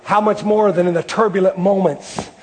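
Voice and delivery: ordinary talk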